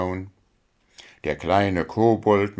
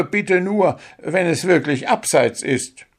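high, hurried, faltering excuse; stammering excuse; 9 semitones higher than usual. A narrator's reading. Dwarf stammering to the referee to save himself.

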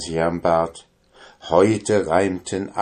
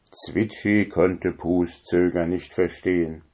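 ordinary delivery

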